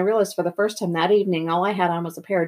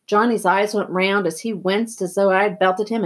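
usual speaking voice

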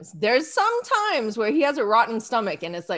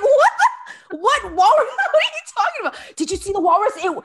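high, laughing, incredulous back-and-forth; high and giggly, voicing both sides; laughing disbelief; 6 semitones higher than usual. Wife laughing at husband's walrus excuse. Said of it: "And I was like".

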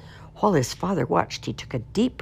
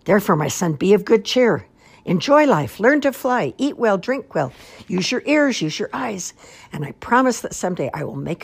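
high, loud string of cheerful exhortations; rally your son's spirits; hearty, ringing fatherly pep talk; rousing encouragement; 5 semitones higher, 7 dB louder than usual. A narrator's reading. Father's rousing advice to son.

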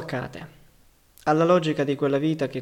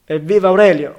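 much louder, higher cheer; cheering shout; 4 semitones higher, 12 dB louder than usual. A narrator's reading. Man in the crowd cheering Aurelio, loud shout. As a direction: hail him at the top of your voice. Cheer after the radio bulletin.

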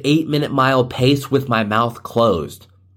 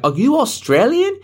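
high-pitched questioner in a put-on British accent; mixed mock accent; 13 semitones higher than usual. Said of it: "I'm like a mix".

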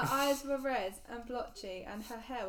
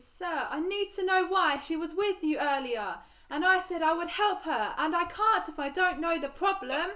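shouted; 4 semitones higher, 6 dB louder than usual. Acted. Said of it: "Harry shouted".

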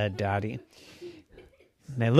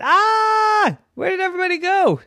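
alarmed; 3 dB louder than usual. Acted.

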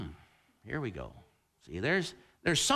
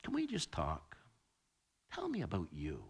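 hushed, lower gentle ask; soft request; 13 semitones lower, 12 dB quieter than usual. Retold or imitated. Grown child asking a parent to talk, hushed. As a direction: ask it softly, tenderly. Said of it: "they say".

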